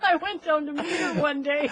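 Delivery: high, loud laughing through the words; high laughter. Real, not acted. Mother laughing hard recalling the lisping speech therapist. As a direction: crack up helplessly mid-sentence.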